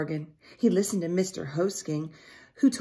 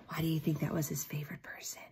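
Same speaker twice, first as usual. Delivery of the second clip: hushed question; 3 semitones lower, 10 dB quieter, much faster than usual. Real, not acted.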